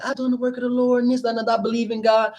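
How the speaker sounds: mildly mock protesting one's own faith; wicked people. Wicked people claiming to do the Lord's work, mock protest. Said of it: "this how wicked people would be".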